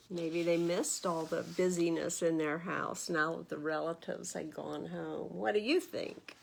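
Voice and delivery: plain reading voice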